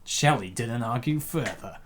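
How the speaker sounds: ordinary talk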